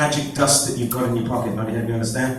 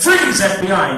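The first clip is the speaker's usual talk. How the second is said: louder, firmer freeze call; shouted command; 3 semitones higher, 6 dB louder, slower than usual. Shown as a demonstration. Hypnotist repeating the spy's shout, loud. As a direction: yell it like a cop.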